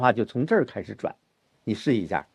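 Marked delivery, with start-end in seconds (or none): none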